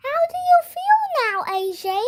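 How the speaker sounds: a cheery school nurse asking; lightly voiced friendly nurse; Missus Cooney voice. A narrator's reading.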